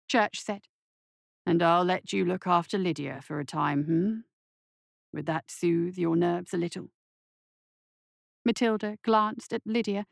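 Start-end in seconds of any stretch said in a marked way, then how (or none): 1.4-6.9 lightly voiced doctor, low and measured; calm, persuasive offer; coaxing doctor. Doctor bargaining with his nervous nurse, coaxing.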